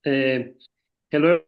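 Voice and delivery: ordinary delivery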